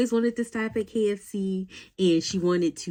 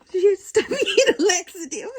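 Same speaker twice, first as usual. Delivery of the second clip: high, laughing, a bit louder; 13 semitones higher, 5 dB louder than usual. Real, not acted. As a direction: let the laugh break the punchline. Laughing at punchline.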